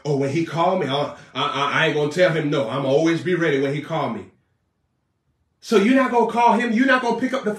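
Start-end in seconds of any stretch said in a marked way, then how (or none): 0.0-4.3 much lower, stammering excuse; mock lazy believer. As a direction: mimic a hesitant believer dodging. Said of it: "That's how you respond to Jesus?".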